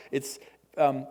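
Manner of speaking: ordinary talk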